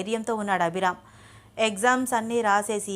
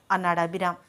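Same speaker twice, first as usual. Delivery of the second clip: ordinary delivery, nothing marked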